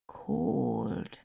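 a low murmured word; soft and low. A narrator's reading. Old Lizzie touches the boy's cold cheek, low and soft.

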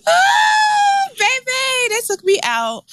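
very high, drawn-out whoop easing down; overcome with amusement. Real, not acted. Reacting to a meme about a stud, high hilarity. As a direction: squeal it as if the joke floored you.

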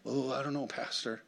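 soft, hesitant doubter's voice; doubtful congregant. Acted. Church member to the pastor, voicing doubt.

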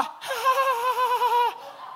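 high burst of laughter; laughing at his own crash. Real, not acted.

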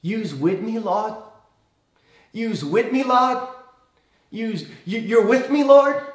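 high, pleading Cajun-tinged question, rising on Lord; Southern, almost Cajun accent. Acted. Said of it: "he says in a Southern, almost Cajun accent".

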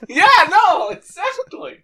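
squeaky high voice. Acted. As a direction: squeak it out cartoonishly.

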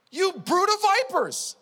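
high, loud, slow condemnation; furious rebuke. Retold or imitated. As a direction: hurl the insult at them. Jesus rebuking Pharisees.

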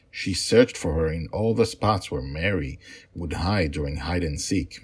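steady storytelling voice for a bedtime tale; story narration. A narrator's reading. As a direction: read it like a father telling his girls a story. Father reads his story aloud.

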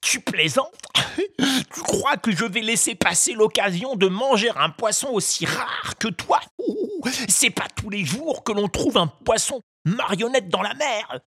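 high, cackling greedy fisherman; a glutton laughing at his prey; mocking ogre. A narrator's reading. (voix d'ogre moqueur et gourmand)

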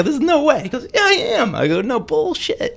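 high-pitched incredulous protest; retold disbelief. Fan to the supposed [name], mock incredulous. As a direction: act out refusing to believe it. Acts disbelieving back-and-forth.

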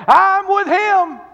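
very high, loud, slow cry; high slow preaching shout; peak shout. Retold or imitated. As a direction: cry it out at full force. Peter's boast at its peak.

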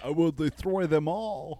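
drawn-out mock villain; slow, hammed-up declaration of doom; lightly put-on villain voice; villain line. Acting the manifesto villain line.

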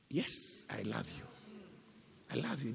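very low, hushed reply; quiet, humbled confession; Peter's subdued answer. Acted. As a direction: answer very softly, almost under the breath. Acts out Peter's subdued answer to Jesus.

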